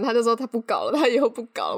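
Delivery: ordinary talk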